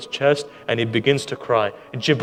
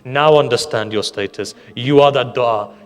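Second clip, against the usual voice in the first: much louder, hammering each phrase, slowing on the last; fiery preaching; 7 dB louder than usual. Real, not acted. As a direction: drive it home to the crowd. Preacher charges the congregation.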